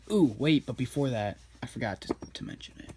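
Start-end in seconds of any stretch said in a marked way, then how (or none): none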